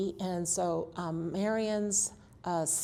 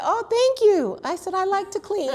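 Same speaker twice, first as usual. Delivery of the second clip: cheery self-quote; 13 semitones higher, 8 dB louder than usual. Retold or imitated. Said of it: "And then I said".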